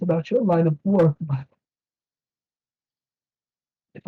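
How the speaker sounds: put-on high, earnest old priest's warning. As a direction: lean in and speak frankly. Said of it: "priest speaks frankly".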